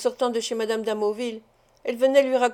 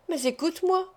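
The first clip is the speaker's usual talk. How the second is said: higher-pitched desperate plea; desperate cry; 6 semitones higher than usual. A narrator's reading. Kneeling at his feet, she begs in a desperate, higher cry. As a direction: cry out to be heard. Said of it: "s'écria-t-elle".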